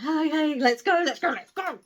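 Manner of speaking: loud, high, flustered ushering; mock nervous fiancé rushing everyone along; nervous hurrying. Fiancé hurrying her away, nervous. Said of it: "gets a bit nervous".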